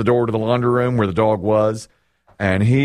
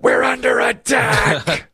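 much higher, slow, hammed-up panic; mock alarm; 12 semitones higher, slower than usual. Retelling his wife's warning at night: hammed-up alarm, much higher. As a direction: play the wife's dramatic alarm, drawn out. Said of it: "My wife turned to me and said".

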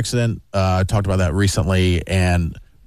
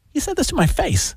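indignant. Real, not acted. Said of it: "indignant retelling".

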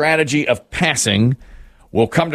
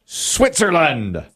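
high, drawn-out announcer call; big slow reveal shout-out; game-show reveal call; 7 semitones higher, much slower than usual. Real, not acted. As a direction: call for the board, stretching each word. Host calls for answer reveal.

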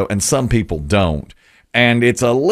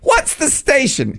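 eager question; slower than usual. Retold or imitated. Imitated stranger asking.

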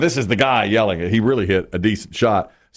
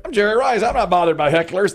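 Jerry Rice; 8 semitones higher than usual. Retold or imitated. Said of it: "if he could have said something like".

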